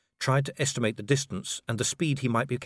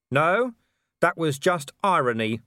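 raised, loud retort; shout; 6 semitones higher, 5 dB louder than usual. A narrator's reading. Vimes shouts back at the mocking werewolf. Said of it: "Vimes shouted".

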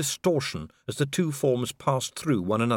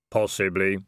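grim reaper voice; 3 semitones lower than usual. Acted. Death speaking.

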